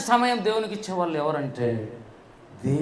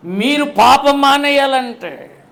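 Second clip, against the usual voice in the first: imitating a preacher; 6 semitones higher, 7 dB louder, slower than usual. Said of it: "ఆయన ఏమంటాడు?".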